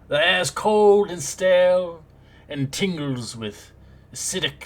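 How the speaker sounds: storyteller painting a gloomy room; scene-setting narration. Acted. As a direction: describe the dungeon like a game master. Game master describes the scene.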